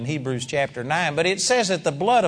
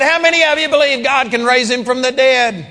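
loud, high challenge to the crowd; shouted challenge; 8 semitones higher, 6 dB louder than usual. Retold or imitated. Preacher daring his clapping audience, shouted high. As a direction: call it out like a dare.

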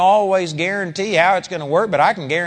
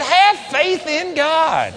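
higher, slow, drawn out; emphatic; 10 semitones higher, much slower than usual. Shown as a demonstration. Jesus urging disciples, emphatic. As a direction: lean on every word. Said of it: "So Jesus said".

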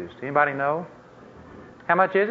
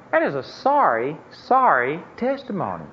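ordinary delivery

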